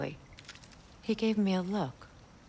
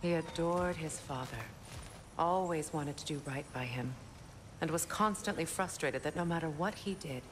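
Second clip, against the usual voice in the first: soft, wistful reminiscence of a son; quietly reflective, tender and sad; subdued confiding; 6 dB quieter than usual. Acted. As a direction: confide it gently, letting the voice drop. Confides about own lost son.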